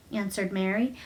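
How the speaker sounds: ordinary delivery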